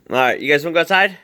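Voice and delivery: bright sing-song invitation to the dogs, quick; excited pet talk. Real, not acted. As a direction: rev the dogs up to go out.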